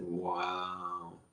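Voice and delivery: normal talking voice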